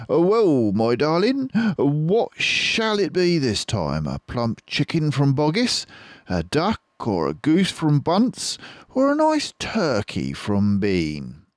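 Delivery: a charming, playful fox; Mister Fox. Acted. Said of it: "Mister Fox would say to Missus Fox".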